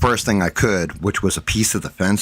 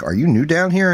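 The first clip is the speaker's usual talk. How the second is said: surprised question; 11 semitones higher, 4 dB louder than usual. Retold or imitated. Asking the new cop.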